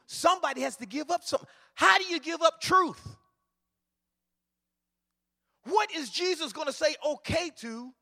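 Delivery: high, loud, shouted preaching; preaching at full force. Acted. Preacher stirs the church about Elijah, shouted sermon. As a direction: drive it home to the congregation.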